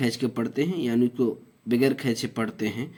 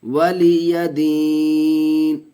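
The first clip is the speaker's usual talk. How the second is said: Quranic recitation; 3 semitones higher, 5 dB louder, much slower than usual. Shown as a demonstration. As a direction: intone each syllable long and melodic like tajweed recitation. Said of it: "teacher recites corrected ending".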